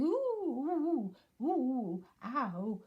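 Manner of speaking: high, loud repeated squeals; bouncing squeals. Acted. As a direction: squeal with every jump. Piglet bouncing.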